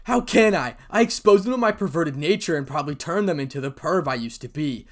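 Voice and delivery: loud, high self-loathing; heated cry easing at the very end; anguished outburst. A narrator's reading. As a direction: burst out, raging at yourself. Anguished outburst after punching wall.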